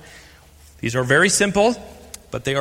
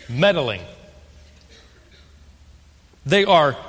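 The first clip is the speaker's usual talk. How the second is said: ordinary talk, nothing marked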